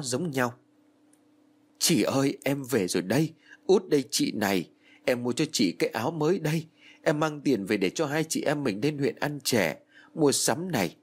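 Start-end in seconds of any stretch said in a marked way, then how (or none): 1.8-11.0 higher, tearful pleading at the coffin; pleading with dead sister. Acted. Girl at her sister's coffin, pleading tearfully. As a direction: beg her sister to come back.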